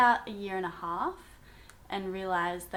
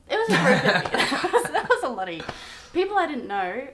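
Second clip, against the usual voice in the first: higher, loud, laughing through it; 6 semitones higher, 10 dB louder, faster than usual. Real, not acted. Telling how she walked in on strangers, laughing. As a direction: laugh at the memory. Laughing retelling of awkward entry.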